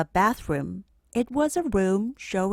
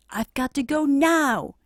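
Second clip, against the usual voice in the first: put-on higher, louder boyish urgency; hurried boy; 7 semitones higher, 5 dB louder than usual. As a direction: blurt it, desperate to leave. Arthur in a hurry.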